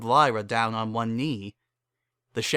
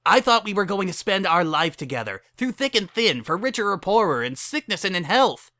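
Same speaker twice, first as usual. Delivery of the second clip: higher, louder hurt reproach; hurt, angry Lyra; 8 semitones higher, 3 dB louder than usual. Acted. Lyra reproaching her fiancée, high and hurt. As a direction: throw the vows back at her.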